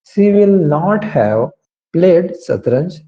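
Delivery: very slow, word by word; slow model sentence. Shown as a demonstration. Teacher dictating the sentence slowly to the class. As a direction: spell out each word slowly for students. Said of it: "तो कैसे बनेगा ये?".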